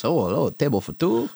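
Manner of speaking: put-on old Italian waiter voice; Italian accent. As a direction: ham up a friendly Italian host.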